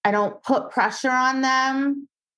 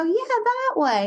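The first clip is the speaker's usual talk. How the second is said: high encouraging voice; 12 semitones higher than usual. Retold or imitated. Trainer answering dog.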